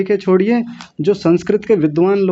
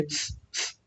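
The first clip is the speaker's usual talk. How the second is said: soft unvoiced hiss through teeth; 19 dB quieter than usual. Shown as a demonstration. Teacher demonstrates the half स hiss. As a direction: hiss like shooing a cat. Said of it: "जैसे दांतों में से आवाज निकलते हैं".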